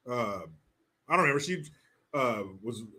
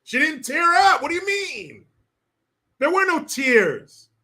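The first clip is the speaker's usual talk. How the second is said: much higher, outraged disbelief; incredulous; 9 semitones higher, 5 dB louder than usual. Real, not acted. Streamer protesting to chat that she never cried, high and incredulous.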